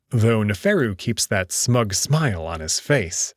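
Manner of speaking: usual speaking voice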